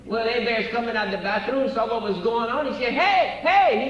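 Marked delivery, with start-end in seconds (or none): 2.9-3.9 higher, louder call of alarm. Acted. Friend shouting at the bartender over the body, alarmed call. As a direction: shout hey to stop him.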